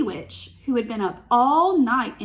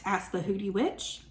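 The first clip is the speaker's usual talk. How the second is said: ordinary delivery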